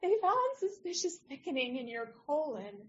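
high, wobbly delivery of bad news; a nervous young doctor barely holding it together; trembling lip. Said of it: "trembling lip".

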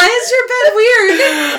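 high, slow, laughing and crying. Real, not acted. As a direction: squeal it through helpless laughter. Laughing at friend's trick.

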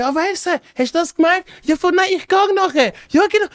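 lightly voiced fake conversation; mock fake chat. Retold friend faking a conversation.

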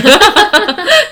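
high loud laughing burst; burst of laughter. Real, not acted. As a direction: break into a big real laugh. 听到卷舌的笑点. (爆笑)

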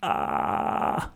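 low creaky vocal fry. Shown as a demonstration. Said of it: "Do you know what vocal fry is?".

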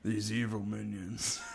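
quiet mock-menacing impression, amused; Javier Bardem. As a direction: play the soft-spoken villain for a laugh. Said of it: "Javier Bardem in this Despicable Me universe".

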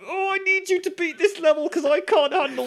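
high, loud, half-laughing plea; tense pleading. Retold or imitated. Husband begging wife to beat the level, high laughing plea. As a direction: beg her to finish the level. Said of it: "I'm like".

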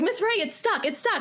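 imitating a panicking pupil, high-pitched; mock freak-out. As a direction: play a kid freaking out, without shouting. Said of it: "freaking out at this point".

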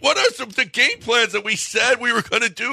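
shouted very high, exasperated at the team; imitating a fed-up player yelling at teammates; retold high exasperated yell, fading toward the end; frustrated yell. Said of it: "He's like".

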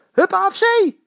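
high, loud screamed cheer; shrill cheer. A narrator's reading. Eddy cheering his club over the noise, loud shriek. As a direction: scream it above the crowd. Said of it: "gilt Eddy boven alles uit".

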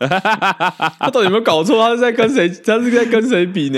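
laughing disbelief. Real, not acted. Mocking a fan comparison, laughing.

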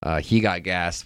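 normal talking voice

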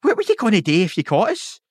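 lightly hammed-up disbelief; mocking question. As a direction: needle him with the question. Asking the firework guy.

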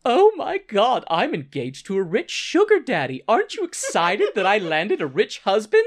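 hammed-up gloating excitement; gushing bragging. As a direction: show off the news breathlessly. Sibel gushes over engagement, acted.

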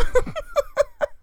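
high, light giggle; short high-pitched chuckle; rueful laugh. Real, not acted. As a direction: laugh at your own mistake. Laughs at own language mistake.

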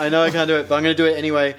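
usual speaking voice

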